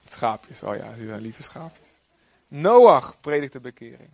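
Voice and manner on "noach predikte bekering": slow, loud, heavy with emphasis; slow emphatic preaching. Real, not acted. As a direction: pound out each word from the pulpit. Preacher booms Noah's message. (langzaam en luid gepredikt)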